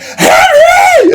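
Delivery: a very high, loud yell of the name; frantic shout. Acted. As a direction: scream for the lost bird. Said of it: "He's like".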